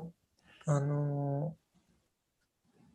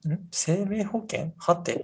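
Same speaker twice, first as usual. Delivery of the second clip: higher, questioning mock bafflement; clueless puzzlement; 5 semitones higher, slower than usual. Reacting to a question about life insurance, clueless puzzlement. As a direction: act out not having a clue. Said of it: "全然分かってないです みたいな感じのリアクション".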